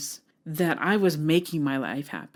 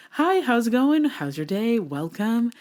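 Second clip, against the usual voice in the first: bright, high customer service greeting; chirpy front-desk hello; work voice; 6 semitones higher, 3 dB louder than usual. Shown as a demonstration. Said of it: "my work voice".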